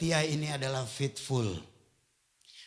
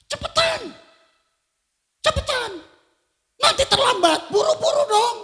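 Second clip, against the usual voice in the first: high, loud hammed-up hurrying wife; wife rushing husband to leave; impatient wife; 9 dB louder than usual. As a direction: nag him to hurry, laughing. Wife rushing him after marriage.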